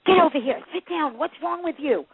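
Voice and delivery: impatient mom scolding a restless kid, mock yell; mock scolding parent. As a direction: bark it like a fed-up parent at a restaurant. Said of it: "parents say to kids when they run around restaurants".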